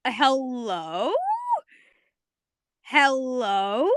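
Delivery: drawn-out hello with a steep rising end; incredulous. Acted. Viewers reacting to Marceline's jab, incredulous. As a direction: stretch it into a disbelieving question.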